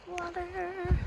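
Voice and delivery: high excited call; happy cry of relief. Real, not acted. Water spotted again.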